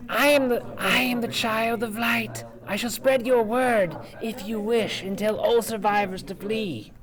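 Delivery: high, airy little fairy voice; a tiny glowing fairy making a grand offer. Acted. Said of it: "the little fairy ... flies down to you".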